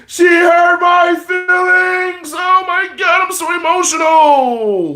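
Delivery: high, slow, sing-song mock wail; mock whiny crybaby. Mocking a salty ex-boyfriend.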